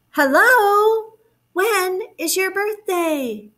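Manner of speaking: high, drawn-out call; calling like Bear. Shown as a demonstration. Teacher models bear's call for children, calling out. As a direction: call it out slowly for children to copy. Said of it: "Let's repeat what Bear said".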